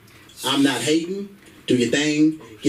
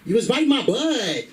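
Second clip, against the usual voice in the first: higher-pitched girlfriend impression; mock woman's voice, pitched up; 7 semitones higher than usual. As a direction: answer back as the imitated girlfriend.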